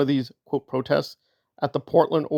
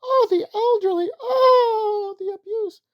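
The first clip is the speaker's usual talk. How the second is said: much higher, slow, hammed-up mock crying; mock wailing; slower than usual. Mocking critics play-acting grief with a high mock cry. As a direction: wail it like a fake mourner. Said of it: "They're screaming".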